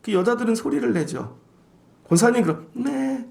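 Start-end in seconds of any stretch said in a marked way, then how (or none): 2.7-3.3 a small high timid yes; soft high compliant reply; meek yes. Retold or imitated. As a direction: answer like a shy deaconess. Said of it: "맨날 이렇게 얘기하잖아요".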